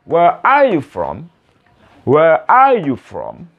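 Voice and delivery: slow WHERE ARE YOU FROM with long open ARE; careful model reading. Shown as a demonstration. Teacher models the written question for beginners, slowly and clearly. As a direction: space out each word. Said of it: "perhatikan juga tulisannya ya".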